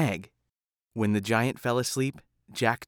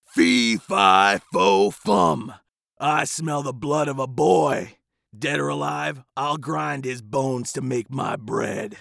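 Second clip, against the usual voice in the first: loud giant's roar, slowing to a menacing threat; booming giant; 4 dB louder, slower than usual. A narrator's reading. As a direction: bellow it out like a hungry giant. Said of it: "The giant cried".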